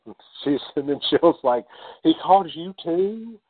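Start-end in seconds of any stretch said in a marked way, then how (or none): 2.0-3.4 high-pitched exasperated question; fed-up retold question, much higher. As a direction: ask it in mock disbelief, pitch shooting up. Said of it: "exasperated with the whole thing".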